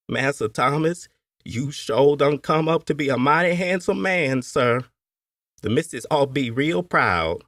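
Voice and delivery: a hint of a fond old servant praising him; admiring old slave woman. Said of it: "Nene had said".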